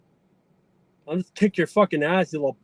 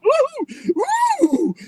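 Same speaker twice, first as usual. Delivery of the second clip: high hooting ooh; a high ooh taunt; taunting hoots. Pretend. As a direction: hoot at them mockingly.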